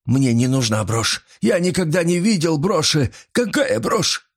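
very high, agitated protest; frantic denial. A narrator's reading. Old man denies ever seeing brooch, frantic. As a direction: disown it in a panic.

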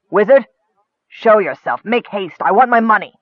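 loud, high goblin demand; lightly voiced rough goblin; goblin chief. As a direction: bark it out like a greedy goblin boss.

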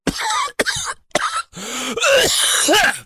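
harsh explosive cough; coughing fit. Acted. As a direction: burst into a painful cough. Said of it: "взорвался кашлем".

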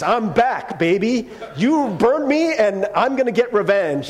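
high, loud mock movie-villain boast; vengeful zombie Jesus. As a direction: ham it up like a revenge movie hero. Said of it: "Jesus comes back to smite".

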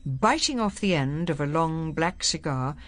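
ordinary delivery

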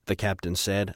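ordinary delivery

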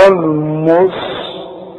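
"المستقيم" drawn out with thick m, s and t; wrongly heavy sounds. Retold or imitated. Teacher shows a common mistake, slow thickened "المستقيم". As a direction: exaggerate the error, heavy "ṭa" in "المستقيم". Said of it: "غلط".

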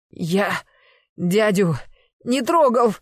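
halting words building to a high, loud cry; strangled denial. Acted. Nephew being choked, denying he touched his uncle, strangled denial. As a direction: gasp it out in pieces, then burst out. Strangled boy denies, face turning blue.

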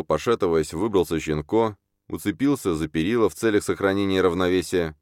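ordinary delivery

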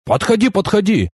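high, eager beckoning; perked up. A narrator's reading. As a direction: wave the newcomer over eagerly. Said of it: "оживился он". (оживлённо подзывать)

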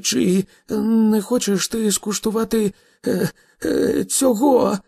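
high, hesitant, stammering challenge; trembling voice. A narrator's reading. Arthur dares the god of thunder, trembling. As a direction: try to hide the fear and fail. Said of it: "хоч як він намагався приховати свій страх голосом".

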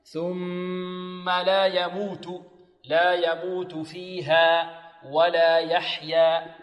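very slow chanted ثم لا يموت with held ghunna on ميم; nasalized mim recitation. Shown as a demonstration. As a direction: hum the doubled ميم in ثم, chanting. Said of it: "وغن ميما ثم نون شددا".

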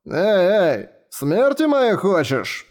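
alarmed drawl. Acted. Horrified reply at Luxeria's name.

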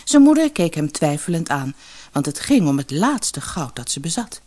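ordinary delivery, nothing marked

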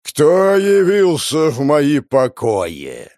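slow, loud thundering monster voice; booming boss voice. Acted. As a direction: boom it out slowly like a dungeon boss. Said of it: "загремел голос".

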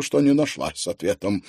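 plain reading voice

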